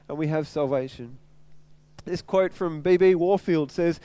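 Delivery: ordinary delivery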